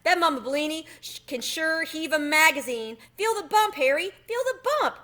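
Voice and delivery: loud, high, hammed-up complaining mouse; a mouse groaning over his bump, pitched high and loud; moaning mouse in pain. A narrator's reading. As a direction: whine loudly about the sore leg. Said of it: "Tucker moaned and massaged his sore spot".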